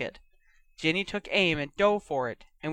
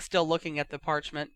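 ordinary delivery

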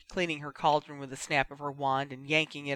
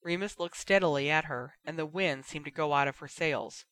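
ordinary delivery, nothing marked

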